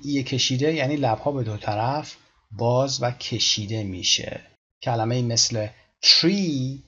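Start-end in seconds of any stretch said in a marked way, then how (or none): 6.0-6.8 "tree" with a drawn-out ee; long stretched ee. Shown as a demonstration. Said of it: "ای باید کشیده باشه".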